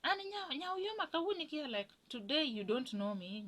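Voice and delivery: high, sweet-talking caller voice; mother's put-on voice. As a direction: play the mother-in-law putting on a sugary phone voice. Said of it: "You change your voice".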